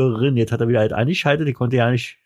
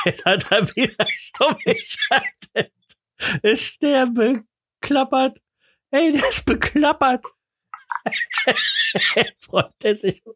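laughing; 12 semitones higher than usual. Real, not acted. Laughing at muted partner.

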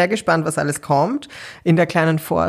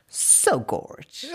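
breathy drag queen ad-lib; Gottmik impression; 8 dB quieter than usual. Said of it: "das war so ein Adlib".

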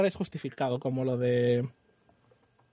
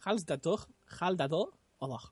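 softer mock German repeat; repeated German line; 6 dB quieter than usual. Shown as a demonstration. Repeating the German dub to friends, softer mock German. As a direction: say it again more softly in German.

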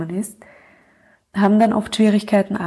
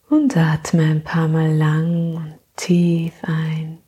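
sleep meditation; 2 semitones lower than usual. Acted. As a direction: gently lull her into sleep.

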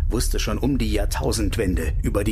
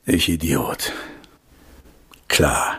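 sarcastic; 9 semitones lower, much slower than usual. Acted. Bitterer Spott nach Absage.

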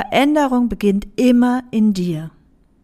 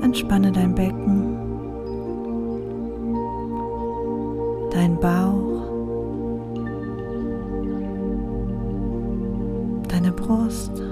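soft spa whisper; 2 semitones lower, 6 dB quieter than usual. Acted. Leads a meditation over music. (ruhige, langsame Meditationsstimme)

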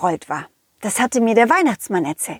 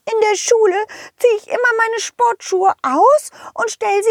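high fairy voice, complaining; little fairy voice; 11 semitones higher than usual. Acted. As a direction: explain as a frustrated little fairy. Fee erklärt. (hohe Feenstimme, genervt)

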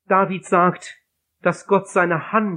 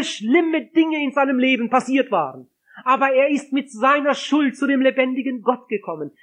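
higher-pitched, impassioned testimony; fervent preaching; 6 semitones higher than usual. Acted. Preacher testifies to the congregation about forgiven guilt, impassioned and higher. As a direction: preach it with rising joy.

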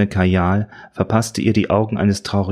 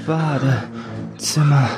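slow drawn-out groan; moaning; 6 semitones higher, much slower than usual. A narrator's reading. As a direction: moan the word. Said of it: "stöhnte es".

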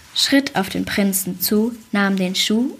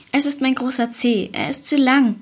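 a mild whine, higher; wailing; 4 semitones higher than usual. Acted. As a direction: lightly whine like a spoiled sister. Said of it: "jammerte sie". (leicht gespieltes Jammern, höher)

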